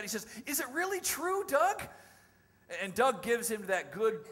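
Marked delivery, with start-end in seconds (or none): 0.4-1.8 high-pitched amazed question, retold; can hardly believe it. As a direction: ask in loud, wondering disbelief. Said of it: "he can't hardly believe that".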